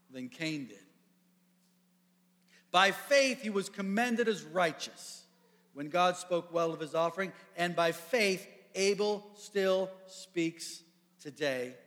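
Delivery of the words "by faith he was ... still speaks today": preaching build. Real, not acted. Preaching build at sermon end.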